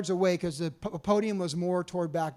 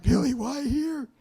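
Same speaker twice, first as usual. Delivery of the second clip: nervous question; 8 semitones higher, slower than usual. Retold or imitated. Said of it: "And I'm like".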